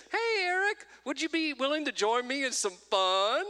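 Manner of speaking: 높은 목소리로, 문자 읽듯이 가볍게 흉내; high text voice. Retold or imitated. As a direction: read his own text in a high, playful voice. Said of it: "I texted Eric and I said".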